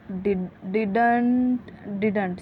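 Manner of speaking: didn't stretched into two syllables, did-unt; wrong didn't. Shown as a demonstration. Teacher showing what not to say, over-full pronunciation. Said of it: "ये गलत है".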